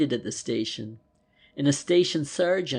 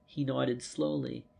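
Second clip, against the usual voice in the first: usual speaking voice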